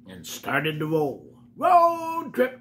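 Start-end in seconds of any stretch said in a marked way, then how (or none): 1.6-2.6 high, loud excited shout; excited cry. Acted. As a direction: yell it like the start of an adventure. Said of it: "cried Pete".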